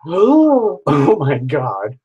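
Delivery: sharp gasp, then a slow oh my God; surprised gasp. Real, not acted. Reader seeing the card for D Q, surprised gasp. Reacting to drawn tarot card.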